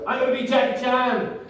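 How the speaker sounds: high, excited boast; mock triumphant declaration. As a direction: announce your dream goal with gusto. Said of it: "I'm gonna start saying it out loud".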